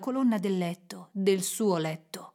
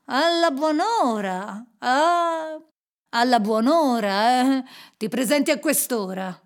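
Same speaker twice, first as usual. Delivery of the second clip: scowling reproach; 3 semitones higher, 5 dB louder than usual. A narrator's reading. Scrooge confronts his late clerk, scowling. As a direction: stretch the greeting into a reprimand. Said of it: "disse Scrooge con la faccia accigliata".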